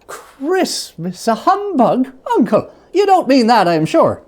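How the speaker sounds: put-on high, eager young man's voice; a nephew surprised and pleading; cheerful nephew. Nephew protesting to his uncle, high and eager.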